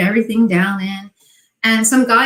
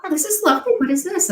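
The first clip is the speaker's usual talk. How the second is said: much higher, sweetly curious; feigned interest; 8 semitones higher, faster than usual. Retold or imitated. At a trade show, asking the vendor with feigned curiosity. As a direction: act casually charmed by the product. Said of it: "I ask a question and I be quiet".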